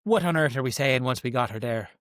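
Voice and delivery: ordinary talk, nothing marked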